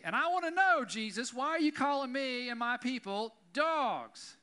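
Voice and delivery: loud, high, slowed plea to Jesus; impassioned cry. Acted. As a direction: cry it out as a wounded, demanding question. Pastor cries out to Jesus.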